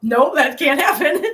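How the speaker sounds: laughing through the words at a big vet bill; giggling disbelief. Real, not acted. To viewers, laughing at the thought of a vet bill, giggling. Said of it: "I'm laughing about that".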